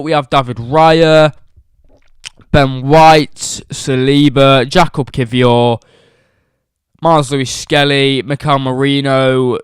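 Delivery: loud announcer reading out the team sheet; booming teamsheet roll call; lineup announcement. Acted. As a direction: call each name like a stadium announcer. Reads out the lineup loudly.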